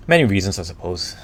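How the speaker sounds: ordinary talk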